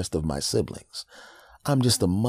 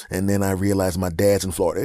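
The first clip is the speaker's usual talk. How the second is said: ordinary talk, nothing marked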